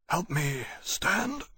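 slow, hoarse and weak. A narrator's reading. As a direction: rasp it out hurt and weak. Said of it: "he said, hoarsely".

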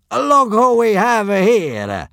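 loud, slow gloating bellow. A narrator's reading. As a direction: boom it out, mocking the prisoner. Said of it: "bellowed the centurion".

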